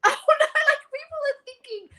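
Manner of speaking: very high, mock-alarmed "oh no"; dismayed inner voice. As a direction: squeak it out like a sudden horrified realisation. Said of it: "and I'm thinking".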